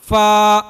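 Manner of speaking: فا said with the lips fully closed; wrong reading. Shown as a demonstration. Teacher demonstrating what not to do, a wrong فا. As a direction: show the mistaken فا. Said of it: "یہ غلط ہو".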